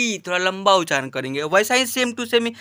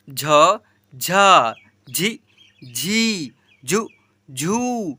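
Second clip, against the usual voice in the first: syllable recitation; 4 semitones lower, much slower than usual. Shown as a demonstration. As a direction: chant the syllables one by one like a drill. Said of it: "ऐसे पढ़ेंगे और उच्चारित करेंगे". (लय में एक-एक अक्षर पढ़ना)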